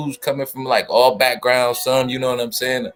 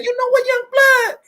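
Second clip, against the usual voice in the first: much higher, louder mock voice of Ism; teasing Ism impression, pitched way up; imitating Ism; 3 dB louder than usual. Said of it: "I can hear him saying".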